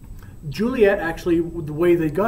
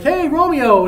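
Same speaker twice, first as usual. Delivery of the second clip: high, loud hail to a pal; hearty acted shout hello; friends' greeting; 9 semitones higher, 7 dB louder than usual. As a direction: call back cheerfully to a friend. Friends greet Romeo back.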